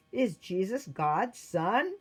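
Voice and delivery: deliberate, probing demand; emperor's challenge. A narrator's reading. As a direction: put the question pointedly, word by word. Emperor questions the defiant priest.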